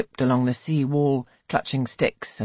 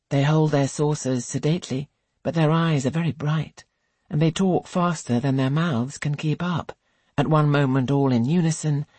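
ordinary delivery, nothing marked

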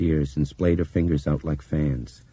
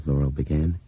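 ordinary delivery

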